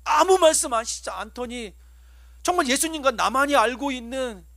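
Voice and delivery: ordinary talk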